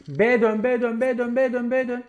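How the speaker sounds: loud urgent call to turn back to B; shouted callout. Real, not acted. Player shouting at teammates to rotate to B, loud and urgent. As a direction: yell the rotation to the team.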